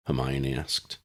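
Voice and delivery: ordinary delivery, nothing marked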